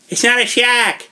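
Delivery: high put-on voice for a quoted movie line; movie character impression. Podcaster finally doing the movie line, high put-on voice. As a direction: pitch it way up and mock the character. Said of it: "I was waiting to do that for a while".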